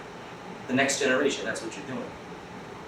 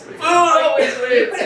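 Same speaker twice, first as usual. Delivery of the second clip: high, slow, laughing repeat; 9 semitones higher, 3 dB louder, much slower than usual. Real, not acted. Teacher cracking up at his own food joke. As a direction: laugh the punchline out. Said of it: "laughing repeat".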